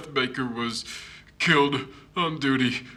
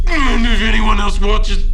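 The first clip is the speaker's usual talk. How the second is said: a full shout, much louder and higher; alarmed shout; 7 semitones higher, 13 dB louder than usual. Acted. Investigator warning that nobody else may watch, shouting. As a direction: yell the warning before it's too late. Urgent warning about the footage.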